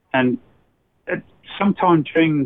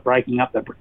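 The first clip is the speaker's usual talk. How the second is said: ordinary talk, nothing marked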